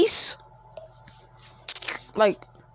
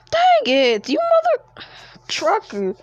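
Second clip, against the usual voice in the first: exclaiming; 9 semitones higher than usual. Real, not acted. Frustrated mock curse.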